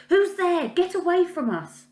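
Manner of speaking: high, loud startled cry into the dark; frightened shout. Acted. A hidden girl shouting at a stranger in the dark, frightened. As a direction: shout it out, scared of what's coming.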